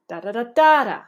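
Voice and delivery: "da" syllables with a loud late peak, then a fall; "da da da DA da" like a falling question; falling intonation. Shown as a demonstration. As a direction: tap out the tune in "da", dropping at the end. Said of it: "you can actually fall".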